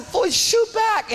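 high, slow, stretched command; urgent order. Retold or imitated. Leader ordering his gunner to shoot back, high stretched command.

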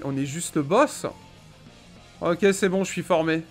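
ordinary talk, nothing marked

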